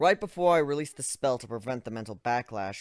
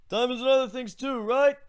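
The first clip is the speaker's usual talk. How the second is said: high character voice; 12 semitones higher, 4 dB louder than usual. Acted. Ivan's line in story.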